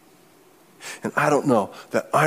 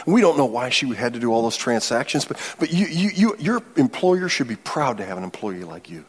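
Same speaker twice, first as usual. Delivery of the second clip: usual speaking voice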